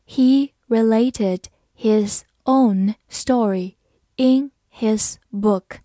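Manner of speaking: slow, deliberate read for learners. Shown as a demonstration. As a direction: stretch every word out slowly. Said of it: "好，慢读一遍。".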